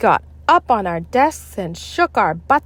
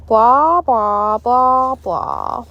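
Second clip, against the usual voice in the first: teacher droning; 4 semitones higher, 5 dB louder, much slower than usual. A narrator's reading. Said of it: "said Miss Banks".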